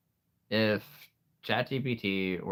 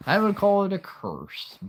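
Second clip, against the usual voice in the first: mock dramatic; 12 semitones higher, 7 dB louder than usual. Mock dramatic about memory.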